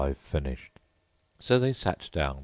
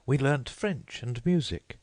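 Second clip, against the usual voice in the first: put-on much higher polite girl voice; Alice's voice; 9 semitones higher than usual. A narrator's reading. Alice thanking the Mock Turtle, high and polite. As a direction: pitch up into a polite young girl.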